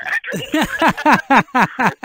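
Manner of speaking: long loud rolling laugh; loud cackling laugh. Real, not acted. Laughs at own story.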